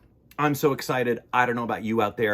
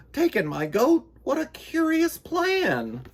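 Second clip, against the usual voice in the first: put-on puzzled old lady. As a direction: ask it in disbelief, high and fussy.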